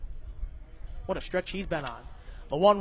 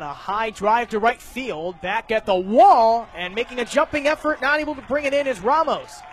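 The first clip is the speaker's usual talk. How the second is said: louder and higher excited play-by-play on a long drive; excited call; 4 semitones higher, 6 dB louder than usual. Real, not acted. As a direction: build the call as the ball heads to the wall. Ball off the wall, live call.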